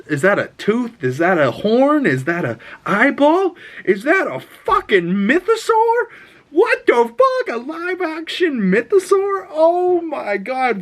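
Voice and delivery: each question climbs until it bursts; real fan freak-out, rising to a squeal; losing it with excitement. Real, not acted. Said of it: "I lost it".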